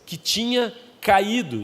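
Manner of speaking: slow preacher reading; preaching. Acted. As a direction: read it out slowly. First reading of phrase.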